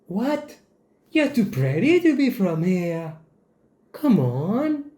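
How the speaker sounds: high, incredulous imitation; mock disbelief. Voicing what the commenter really means, high mock disbelief. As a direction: exaggerate the astonishment, pitch swooping up.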